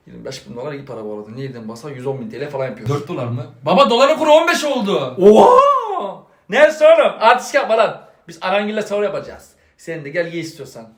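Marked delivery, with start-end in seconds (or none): 0.1-3.5 low, soft, fast mumble to himself; muttered aside. Acted. Father mutters sums to himself. 5.2-6.2 high, loud gasp-word; shocked exclamation. Acted. Father shocked at the dollar rate.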